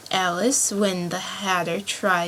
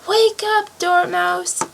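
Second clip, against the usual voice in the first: much higher, loud shout; loud wake-up call; 9 semitones higher, 5 dB louder, slower than usual. A narrator's reading. Hatter and March Hare shouting the Dormouse awake, high and loud. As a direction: rouse a sleeper with a sharp call.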